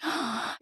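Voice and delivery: sharp gasp of amazement; mock astonished intake of breath; awestruck gasp. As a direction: gasp like a starstruck child. Child's reaction to seeing her idol.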